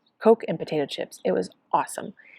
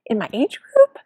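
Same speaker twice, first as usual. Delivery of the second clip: high, louder rising question; astonished; 6 semitones higher, 6 dB louder than usual. Retold or imitated. Friend tells her she placed, astonished and loud. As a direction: gasp it out in surprise.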